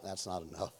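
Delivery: low, quiet deadpan. Retold or imitated. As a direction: mutter it flatly under breath. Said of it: "I'm like".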